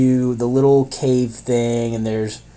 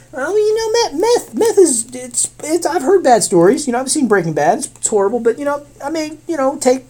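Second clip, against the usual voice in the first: high-pitched, hesitant imitation of a drug user; comic high voice of self-justification; mock rationalizing; 12 semitones higher than usual. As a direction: waver through excuses in a high voice. Acts drug user rationalizing meth.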